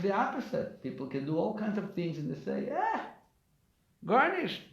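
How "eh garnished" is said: high-pitched "eh" of dismissal; mock self-dismissal, pitched up; dismissive shrug. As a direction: act out someone writing himself off. Voices dismissive people.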